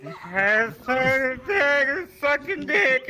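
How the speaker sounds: put-on drunk friend taunt. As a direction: toss it off like a drunk jerk. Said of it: "he's like".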